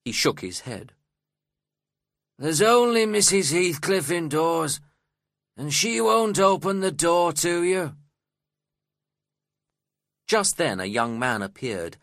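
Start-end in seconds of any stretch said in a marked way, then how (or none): 2.4-8.0 high, loud refusal; gruff servant's raised-voice rebuff; surly servant calling out. A narrator's reading. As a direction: call it down grumpily to an unwanted visitor. Surly servant calls from window.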